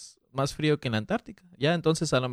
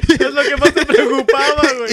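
much higher and louder, laughing through it; teasing friend through laughter, much higher; 11 semitones higher, 11 dB louder than usual. Real, not acted. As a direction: crack up while teasing.

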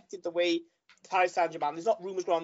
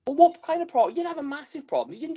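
heated pundit debate; 6 semitones higher, 4 dB louder than usual. Real, not acted. Pundit arguing against co-host.

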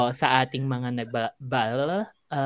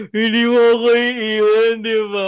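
put-on drunk crying plea; 8 semitones higher, 8 dB louder than usual. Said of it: "Sabi niya".

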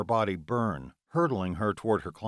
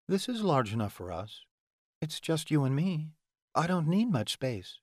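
higher, lighter woman's voice; female character voice; 6 semitones higher than usual. Acted. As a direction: play the heroine, lifted and light. Heroine's voice in dialogue.

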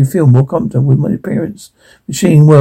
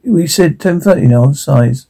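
ordinary delivery, nothing marked

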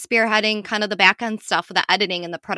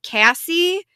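ordinary delivery

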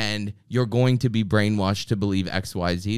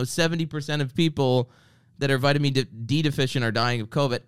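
ordinary talk, nothing marked

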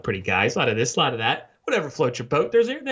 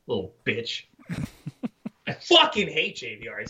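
ordinary talk

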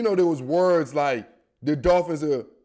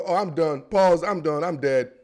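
ordinary talk

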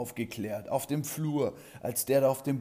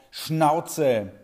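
loud yell; 6 semitones higher, 9 dB louder than usual. Acted. Teacher yells shut up.

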